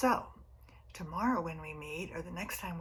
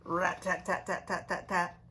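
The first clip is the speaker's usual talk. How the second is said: loud staccato rat-tat-tat; woodpecker tapping; 5 dB louder than usual. Retold or imitated. Reading to a child, rapping out the woodpecker's taps. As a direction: tap it out like a woodpecker on bark. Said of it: "if he taps loud enough".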